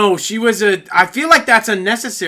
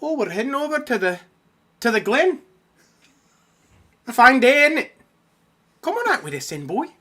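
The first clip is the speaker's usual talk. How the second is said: Scottish woman; 6 dB quieter than usual. Pretend. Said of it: "Scottish woman".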